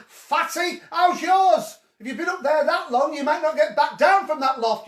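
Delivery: loud, high jeering laugh and taunt; mock teasing. Real, not acted. Ribbing Kyle in the attic, loud high jeering. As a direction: rib him loudly like a sibling. Mocking Kyle's question.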